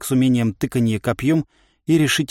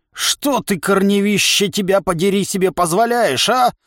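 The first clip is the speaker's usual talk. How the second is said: cackling toothless ranting; 8 semitones higher than usual. Acted. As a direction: screech it like a furious ancient ent. Angry old tree man shouting.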